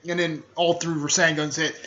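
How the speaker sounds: ordinary talk, nothing marked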